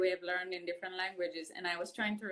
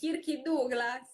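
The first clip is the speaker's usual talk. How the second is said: Kirky Douglas, slow, louder, Italian-style; Italian pronunciation; 5 semitones higher, 6 dB louder, slower than usual. Retold or imitated. [name] retells telling American friends, Italian-accented name. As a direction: mimic how Italians say Kirk Douglas. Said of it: "they were like".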